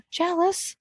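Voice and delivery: high mock-envious gasp; gasp, then sigh out jealous. Real, not acted. Reacting to Disneyland churro.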